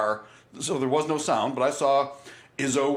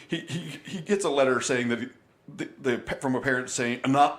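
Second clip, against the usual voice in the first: usual speaking voice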